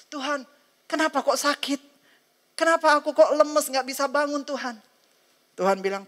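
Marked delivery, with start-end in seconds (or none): 0.1-4.7 sick complaint. Acted. Susan complains she is sick.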